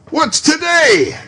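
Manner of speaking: high, loud, slow call from the window; eager call to a boy below. Acted. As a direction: shout down to the street. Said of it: "calling downward to a boy".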